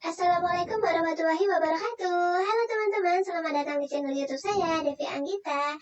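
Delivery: filtered into a woman's voice. Shown as a demonstration. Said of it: "coba suara wanita". (suara wanita)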